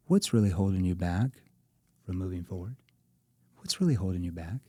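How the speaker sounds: concerned sales question. Shown as a demonstration. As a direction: ask as if you truly worry. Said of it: "Concern tone".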